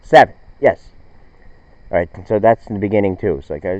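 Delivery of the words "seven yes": confident answer. Shown as a demonstration. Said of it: "I hope I got that right".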